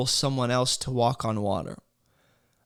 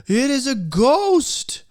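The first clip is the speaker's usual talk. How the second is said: high, slow, loud frightened cry of ghost; terrified cry; 10 semitones higher, 5 dB louder, much slower than usual. Acted. Disciples cry out in fear.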